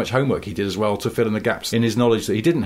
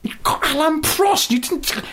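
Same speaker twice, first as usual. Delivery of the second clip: high, astonished disbelief; amazed. Retold or imitated. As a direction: gasp it out in total disbelief. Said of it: "And I was like".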